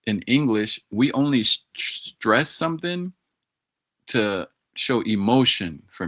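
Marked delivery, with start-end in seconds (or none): 1.8-3.1 "stress" lifted high and stretched; showing stress. Shown as a demonstration. Explaining English stress to his tutor, demonstrating it. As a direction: lean into "stress" and draw it out. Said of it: "demonstrating stress".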